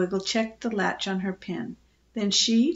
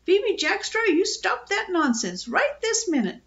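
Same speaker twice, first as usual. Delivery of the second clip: high-pitched bossy bird; stern, high parrot reprimand; scolding parrot; 10 semitones higher than usual. A narrator's reading. Parrot scolds the frightened girl.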